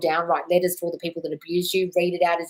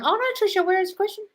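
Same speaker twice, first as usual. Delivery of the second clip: high, surprised call; mock alarm. Real, not acted. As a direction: search anxiously, pitched high. Missing a viewer's question.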